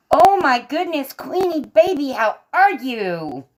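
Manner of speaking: high, loud gushing welcome; delighted greeting. Real, not acted. Streamer spotting a dear friend joining, delighted greeting. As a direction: squeal hello, stretching out the last words.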